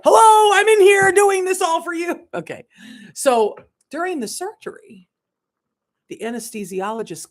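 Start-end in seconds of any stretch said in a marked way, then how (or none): 0.0-2.3 much higher and louder, sing-song call from within; voice of the soul. Pretend. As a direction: play the soul calling out brightly to be noticed. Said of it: "Our soul's like".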